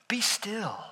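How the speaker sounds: whispered "Be still.". Acted. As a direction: breathe "Be still." as a whisper into the chaos. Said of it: "and simply says".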